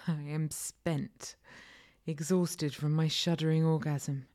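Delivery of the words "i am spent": plain reading voice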